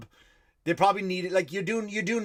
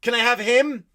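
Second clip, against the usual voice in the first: exasperated demand; 7 semitones higher, 8 dB louder than usual. Retold or imitated. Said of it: "I go".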